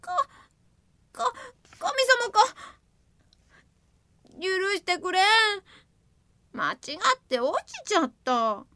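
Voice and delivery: high, stammering desperate excuse; pleading excuse. Acted. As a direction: beg forgiveness, stammering. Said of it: "一生懸命言い訳をした。". (高い声で必死に言い訳する)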